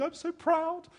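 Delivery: high, breathy-free mock wife voice; a high put-on voice for his wife; impression of wife. Wife cheering on the bridge, high mock voice. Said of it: "that's just my impression".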